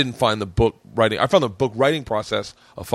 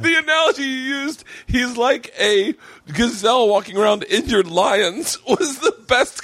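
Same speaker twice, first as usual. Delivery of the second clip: laughing; 11 semitones higher than usual. Real, not acted. Laughing while recalling joke.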